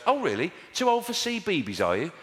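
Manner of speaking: high, mocking sarcastic challenge; heckler put-down. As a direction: take a child down like a heckler. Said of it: "treating the kids like hecklers".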